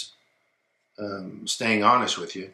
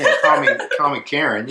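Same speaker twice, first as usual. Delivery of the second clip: laughing; 4 semitones higher, 5 dB louder than usual. Real, not acted. Joking about role-play with wig.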